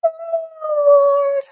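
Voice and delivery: high, disbelieving oh Lord; shocked. Real, not acted. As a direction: caught off guard by the message. Reacting to absurd messages.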